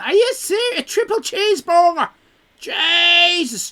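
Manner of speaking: high, loud mock disbelief. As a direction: act outraged at the triple cheeseburger. Mock shock at chat message.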